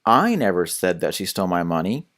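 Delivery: I stressed, tune rising at the end. Shown as a demonstration. Tutor showing how emphasis shifts meaning, stress on I. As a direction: hit the word I and let the line rise. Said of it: "Let me give you a few examples".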